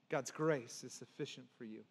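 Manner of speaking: low, soft pulpit landing; quiet, gentle assurance; hushed reassurance. Real, not acted. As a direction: bring it right down and let the promise settle. Quiet landing after preaching climax.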